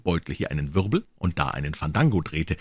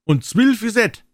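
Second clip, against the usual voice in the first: high, loud and slow; little old men's dialect; 13 semitones higher, 5 dB louder, slower than usual. Acted. Little old men agreeing it is twelve, high and slow. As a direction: drawl it out in a squeaky dialect. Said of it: "sagten die kleinen alten Herren".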